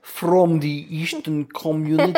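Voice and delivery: put-on Eastern European voice; Eastern European accent. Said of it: "you just did an Eastern European accent".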